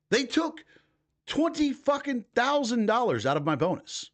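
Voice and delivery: angry outburst. Real, not acted. Venting to viewers about taxed bonus, angry. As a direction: rant it, outraged at the taxman.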